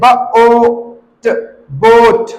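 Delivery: ब, ओ, ट apart, then long बोट; sounded out slowly. Shown as a demonstration. Teacher showing long ओ, sounded out and blended. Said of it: "long vowel sound होता है ओ".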